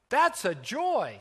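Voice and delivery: bright high exclamation, drawn out slowly; delighted child. A narrator's reading.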